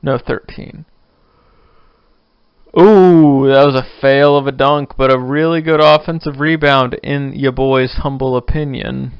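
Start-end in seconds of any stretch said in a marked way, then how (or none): 2.8-9.1 loud courtside-style reaction; excited game reaction. Real, not acted. Host watching basketball while recording, loud excited reaction. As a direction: react loudly to the play as it happens.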